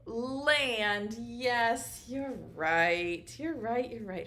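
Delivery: normal talking voice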